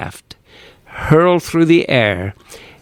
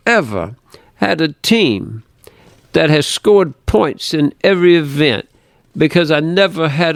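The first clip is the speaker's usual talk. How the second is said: put-on higher, strong Brooklyn accent, coach addressing the team; Coach Rosen's Brooklyn dialect; 4 semitones higher than usual. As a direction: talk like a Brooklyn coach giving a speech, pitched up. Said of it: "He said in his pronounced Brooklyn dialect".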